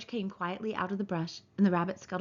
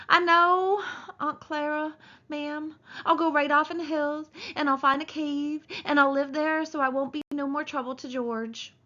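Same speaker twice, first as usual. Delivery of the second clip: put-on higher, louder childlike pleading; a big simple man begging forgiveness; Lenny pleading with his aunt, higher and louder; 7 semitones higher, 4 dB louder than usual. A narrator's reading. Lenny promising to leave.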